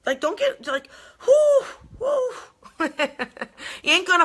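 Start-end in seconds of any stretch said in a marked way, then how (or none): 0.6-2.3 high whooping burst; mock freak-out whoo. Shown as a demonstration. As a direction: act out a panicky whoo. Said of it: "you're getting all upset".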